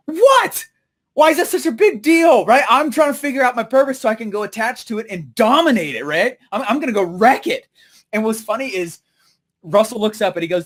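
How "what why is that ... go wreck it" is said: high, loud outburst easing into insistence; indignant disbelief. Retold or imitated. Speaker to laughing friends, indignant disbelief. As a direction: burst out in mock outrage, then settle.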